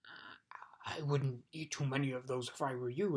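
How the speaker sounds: a hint of a gruff dog; Tock's voice. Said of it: "advised Tock".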